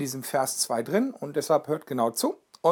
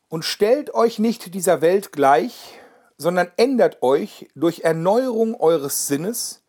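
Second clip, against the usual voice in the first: slow, solemn and louder verse reading; scripture reading; 2 semitones higher, 5 dB louder than usual. Acted. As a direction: proclaim each word of the verse. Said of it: "Ich wiederhole das nochmal".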